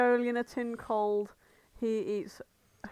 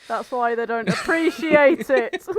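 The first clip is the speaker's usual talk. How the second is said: laughing; 2 semitones higher, 10 dB louder than usual. Real, not acted. Laughing through joke.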